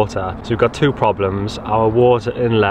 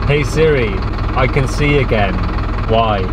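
ordinary talk, nothing marked

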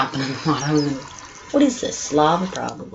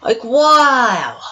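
awed shout; 4 semitones higher, 4 dB louder than usual. Real, not acted. Awed at huge cave.

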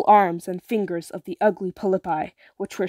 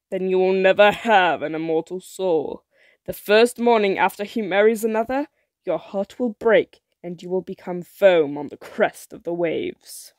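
put-on louder, ominous old sorceress; sea witch voice; 3 dB louder than usual. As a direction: witch warning of doom. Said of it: "said the witch".

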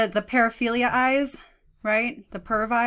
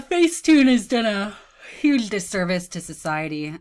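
ordinary talk

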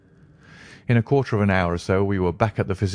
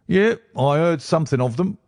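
high, offhand police inspector; a confident inspector brushing it off; breezy inspector; 9 semitones higher than usual. A narrator's reading. Inspector answers Holmes.

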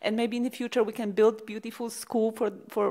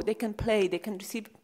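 usual speaking voice

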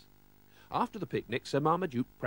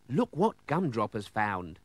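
ordinary delivery, nothing marked

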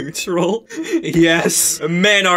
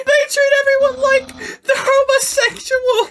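shrill cry. Acted. Parody character shrieking.

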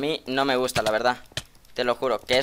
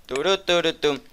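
normal talking voice

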